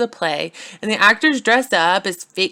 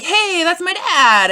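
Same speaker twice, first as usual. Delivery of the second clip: much higher, slow goofy surprise; surprised Hamlet; 11 semitones higher, 4 dB louder, slower than usual. Acted. Hamlet to the ghost of his father, goofy high surprise. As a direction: recognize Dad, high and drawn out.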